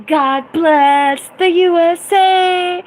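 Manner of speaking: high, slow, booming proclamation; hammed-up flag-waving salute; mock patriotic cry. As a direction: belt it out like a hammy patriot.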